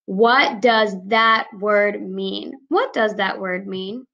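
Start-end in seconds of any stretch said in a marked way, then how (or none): 0.1-2.5 slow, deliberate model sentence; slow example sentence. Shown as a demonstration. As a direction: read it slowly for the children. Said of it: "My sentence example".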